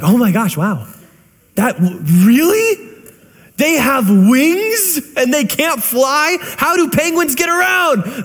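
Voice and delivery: high, slow, wide-eyed gushing; hammed-up excitement over penguins; mock excited amazement. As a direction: play up the wonder for your kid. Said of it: "in her most excited way".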